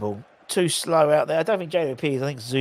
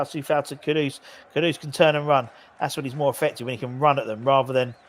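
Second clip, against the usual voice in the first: usual speaking voice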